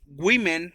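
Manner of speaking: "women" said slowly as "wimen"; pronunciation demo. Shown as a demonstration. Teacher shows Spanish speakers how "women" is pronounced, pronunciation demo. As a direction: model "women" with a short i in the first syllable. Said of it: "así se pronuncia".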